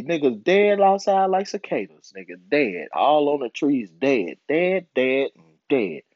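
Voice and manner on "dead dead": ordinary talk, nothing marked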